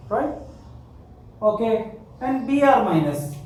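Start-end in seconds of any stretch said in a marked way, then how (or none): none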